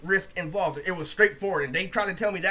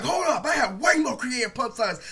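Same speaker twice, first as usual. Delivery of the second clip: worked-up, higher-pitched retort; emotional comeback; 4 semitones higher, 3 dB louder than usual. Retold or imitated. Roaster hitting back at Sabo in a battle, emotional. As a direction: fire back with too much emotion. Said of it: "I was saying it in emotion".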